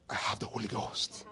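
soft whispered affirmation; whispered confession. Shown as a demonstration. Preacher models the congregation's reply, whispered. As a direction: breathe it out like a secret you treasure.

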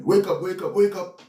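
high repeated wake-up call; rousing call. Acted. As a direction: call out to rouse sleeping soldiers. Acts out rousing the army.

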